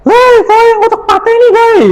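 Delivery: high-pitched mock scolding; scolding teacher. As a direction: call out sharply like a teacher telling a pupil off. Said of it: "kita langsung marahi".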